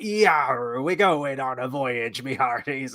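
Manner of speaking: a light imitation of a droid speaking like a pirate; a hammed-up pirate, but only a hint of it. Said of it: "Why is the droid speaking like this?".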